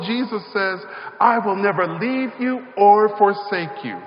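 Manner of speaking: loud, high, building sermon cadence; fervent pulpit preaching; preaching at full force. Acted. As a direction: drive each line home to the congregation. Preaches the call-and-response at full force.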